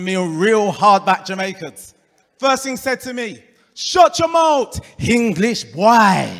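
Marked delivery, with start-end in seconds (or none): 3.7-6.3 slow, loud Jamaican patois order; stern Jamaican border officer telling off an English visitor. Retold or imitated. Jamaican passport officer's quote.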